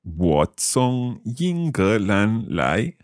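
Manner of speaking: very slow, syllable by syllable; slow learner's repeat. Shown as a demonstration. Learner repeating the teacher's Chinese phrase, very slowly. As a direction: draw out each tone carefully. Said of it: "saying each of these phrases quite slowly".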